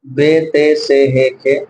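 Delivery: "بے، تے، سے، ہے، کے" with Urdu "ay" endings; Urdu-accent letter names. Shown as a demonstration. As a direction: list "بے، تے، سے، ہے، کے" the Urdu way, each ending in e. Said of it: "یعنی اردو ایکسنٹ ہے".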